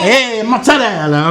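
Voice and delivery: slow, drawn-out Italian "hey, mozzarella"; playful Italian exclamation; Italian flourish. Shown as a demonstration. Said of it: "You gotta go like this when you say".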